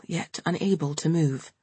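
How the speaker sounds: usual speaking voice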